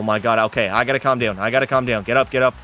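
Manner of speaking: normal talking voice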